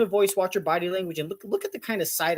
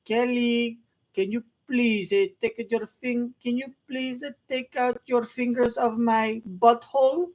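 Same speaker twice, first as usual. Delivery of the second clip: hammed-up French accent, raised pitch; 5 semitones higher, slower than usual. Commentator mocking the French child talking to Kelly, hammed-up French accent. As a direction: parody a French child, slow and deliberate. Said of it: "English with a French accent".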